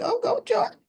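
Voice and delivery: a sudden high, excited cheer; egging her on with glee. Real, not acted. Fan watching the kiss cheers Jordan on, high and excited.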